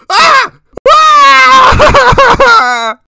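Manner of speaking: 3 shriek as if grabbed in the dark; 4 piercing high scream; 1 terrified screams. Acted. Terrified by sudden scare.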